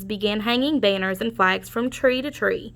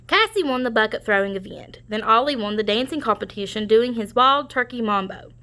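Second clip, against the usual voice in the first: ordinary delivery, nothing marked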